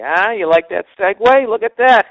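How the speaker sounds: loud, high self-congratulation; proud gloat. Real, not acted. Comic crowing to audience over his radio plug, loud. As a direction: crow about the smooth plug. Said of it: "That was a thing of beauty".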